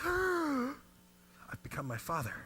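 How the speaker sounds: sudden gasp, then high, slow and stunned; the moment he realizes he became his dad, gasping and slow; gasping realization. Real, not acted. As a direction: gasp as it dawns on you. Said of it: "and I stop".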